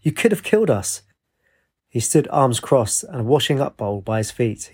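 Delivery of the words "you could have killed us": spluttered. A narrator's reading. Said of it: "she spluttered".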